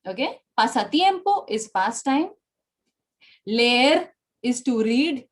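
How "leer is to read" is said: leer said slowly as lay-ER with a tapped r; correct Spanish leer. Shown as a demonstration. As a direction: stretch out leer as le-er, two clear vowels. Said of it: "It is not leer, okay? It is leer".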